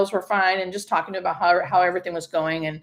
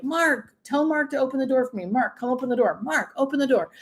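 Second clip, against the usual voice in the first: mild mock calling; 6 semitones higher than usual. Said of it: "she would yell for".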